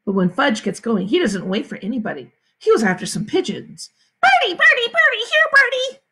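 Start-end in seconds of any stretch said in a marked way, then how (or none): 4.2-6.0 put-on very high, loud toddler call; small boy calling. A narrator's reading. Fudge chasing pigeons, very high loud little-boy call. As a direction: squeal out to the birds like a little kid. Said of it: "he called".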